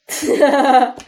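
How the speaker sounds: loud guffaw; bear's laugh. A narrator's reading. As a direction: laugh till the eyes water. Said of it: "Utíral si medvěd oči".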